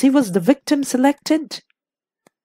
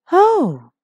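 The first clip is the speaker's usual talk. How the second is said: higher, falling "oh" of realisation; changed voice; 6 semitones higher than usual. A narrator's reading. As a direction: let the voice change as it dawns on you. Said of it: "in a changed voice".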